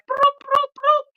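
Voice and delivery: string of high, even broops; imitated lever noise. Patient imitating the lever reacting on her stomach, high broops. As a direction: make the lever go crazy with high-pitched blips. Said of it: "it was like".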